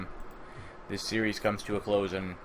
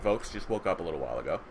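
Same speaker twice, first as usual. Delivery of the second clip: usual speaking voice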